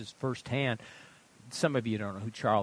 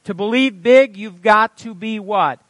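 loud, raised pulpit question; emphatic preaching; 9 semitones higher, 15 dB louder than usual. Real, not acted. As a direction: quiz the congregation forcefully. Preaches at congregation.

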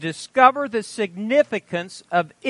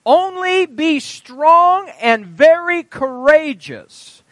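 slow, higher, louder on strong and very courageous; emphatic proclaiming; 9 semitones higher, 8 dB louder, much slower than usual. Acted. Preacher urging the church, slow emphatic proclamation. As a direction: lean hard into every word.

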